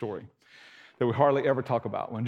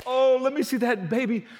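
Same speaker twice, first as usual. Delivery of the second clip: high, bright mock old woman; lightly voiced eager old lady; 10 semitones higher than usual. Anna running up to see the baby, lightly voiced. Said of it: "She runs up and goes".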